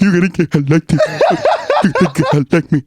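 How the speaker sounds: odd put-on rap voice; mimicked rapper voice. Mocking the new songs to a friend, put-on rapper voice. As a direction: parody a rapper's quirky delivery.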